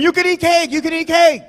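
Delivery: high-pitched repeated holler; hammed-up cry to the whole room; screaming. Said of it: "I'm just screaming".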